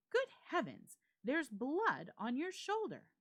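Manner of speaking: high, loud gasp of shock; shocked exclamation. Acted. Friend spotting blood on the narrator's shoulder, shocked. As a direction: recoil at the sight of blood.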